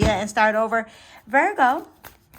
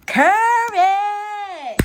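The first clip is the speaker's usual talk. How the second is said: much higher and louder, drawn out; triumphant reveal; 12 semitones higher, 6 dB louder than usual. Real, not acted. Reader unveiling July's word to Virgo, calling it out high and proud. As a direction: ring out the word like a victory. Announcing the key card.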